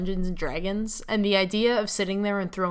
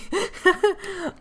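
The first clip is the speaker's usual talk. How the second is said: high laugh; 10 semitones higher than usual. Acted. Laughing at team name.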